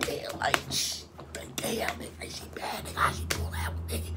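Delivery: breathy hushed whisper among the homies. Retold or imitated. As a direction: whisper it so she can't hear. Homies whispering praise inside.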